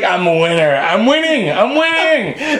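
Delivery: high, slow, laughing rant; Charlie Sheen. Retold or imitated. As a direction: crow it like a celebrity rant. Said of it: "he's like".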